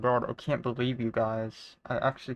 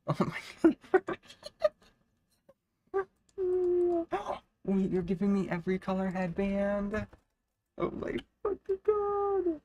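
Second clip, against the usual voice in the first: high, squealing, laughing amazement; overjoyed disbelief; 9 semitones higher, 4 dB louder than usual. Real, not acted. Streamer reacting to being given every color headband, overjoyed disbelief. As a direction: squeal through laughter, overwhelmed by the gift.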